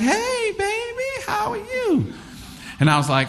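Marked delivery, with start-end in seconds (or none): 0.0-2.1 very high, sugary auntie voice; aunties' sweet greeting. Retold or imitated. Aunties upstairs greeting the boy, high sweet coo.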